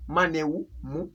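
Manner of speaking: "maneu" recited, then blended into "mu"; name the letters "maneu", then sound out "mu"; teacher sounding out "maneu, mu"; syllable spelling drill. Shown as a demonstration. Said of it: "to read words like".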